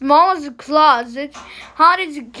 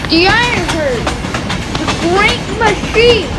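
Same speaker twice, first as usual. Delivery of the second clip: rising, louder, thrilled storyteller; excited narration; 5 semitones higher, 5 dB louder than usual. A narrator's reading. Reading a train story to children, bursting with excitement. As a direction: announce the train like a wonder arriving.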